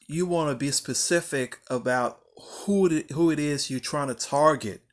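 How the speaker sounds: normal talking voice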